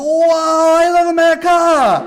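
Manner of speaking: put-on very high, loud accented exclamation; imitating Toshi. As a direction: shout it with delight. Toshi answering the cars.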